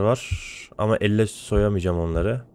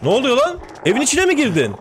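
much higher, louder alarmed yell; startled outraged shout; 13 semitones higher, 3 dB louder than usual. Real, not acted. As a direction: yelp in shocked disbelief. Attacked inside the house.

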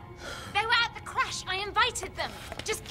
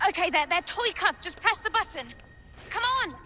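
high, pressed, impatient instructions; urgent hurry; 5 semitones higher than usual. Acted. Captor freeing prisoner as the house is surrounded, urgent hurry. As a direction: hurry them along, pitch climbing.

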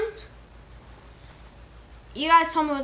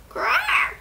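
high squawking dinosaur cry; pterodactyl shriek; 13 semitones higher than usual. Pretend. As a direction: screech like a flying dinosaur. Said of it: "That was my best pterodactyl noise".

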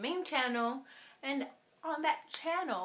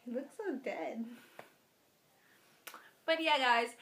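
normal talking voice

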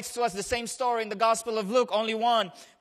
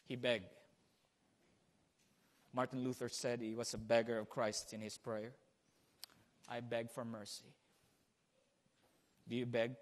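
hushed reverence; 10 semitones lower, 13 dB quieter than usual. Acted. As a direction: draw them in with a near whisper. Hushed sermon.

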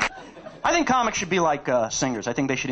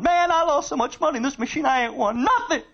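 a hint of a whiny, higher loser; mock lunatic gambler; 10 semitones higher than usual. Comic imitating a casino lunatic, mildly whiny. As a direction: lightly voice the crazed gambler.

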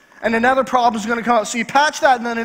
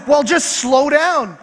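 higher, drawn-out retold plea; mock exasperated advice; 5 semitones higher, 4 dB louder, much slower than usual. Husband telling wife how to stop shaking, mock exasperated. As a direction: stretch it as if stating the obvious.

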